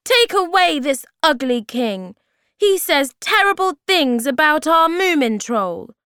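high, loud outraged order; shouting. A narrator's reading. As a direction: call out the accusation at full voice. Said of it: "shouted Sniff".

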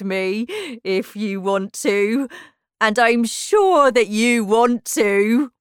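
loud, slow, self-important character; pompous Humpty Dumpty. Acted. Humpty Dumpty boasting to Alice of knowing the king, pompous and slow. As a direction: boast grandly, stretching out the words.

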